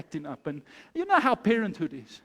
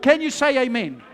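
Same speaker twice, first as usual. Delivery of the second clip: usual speaking voice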